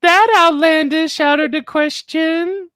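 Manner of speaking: high, put-on girly voice; mock Leah. Imitating Leah confessing her crush on Quinn, high mock voice. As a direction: ham up Leah's confession. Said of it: "Leah goes".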